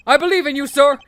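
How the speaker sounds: rally him with booming loyalty; gung-ho detective voice; loyal detective, fervent. Acted. Plays the detective.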